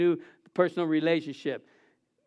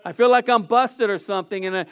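ordinary talk, nothing marked

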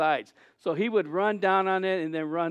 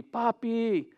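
higher-pitched but restrained call; child's cry retold; 4 semitones higher than usual. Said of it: "he cried out to me".

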